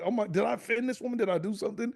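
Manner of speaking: high, quick worried questions; mock fearful. Stranger wonders if she offended the woman, mock fearful. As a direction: imitate a bewildered, frightened stranger. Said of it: "she was in fear of her life".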